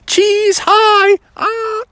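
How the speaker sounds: very high, loud cheesy greeting; squealed, playful photo-pose voice; posing for photos. Acted. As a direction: ham it up for the cameras. Bear posing for the cameras.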